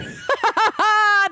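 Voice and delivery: high-pitched burst of laughter; shocked laughter. Real, not acted. Hearing the creepy account read out, shocked laughter.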